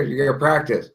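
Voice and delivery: ordinary delivery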